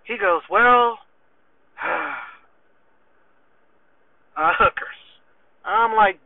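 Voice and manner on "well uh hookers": stall, sigh, then own up; reluctant confession. Retold or imitated. Soldier confessing to his sergeant, sheepishly reluctant.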